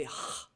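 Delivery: breathy throat sound for "h"; isolated Carioca h, no vowel; guttural h. Shown as a demonstration. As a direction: demonstrate the Carioca h as a bare rasp of air. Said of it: "they say".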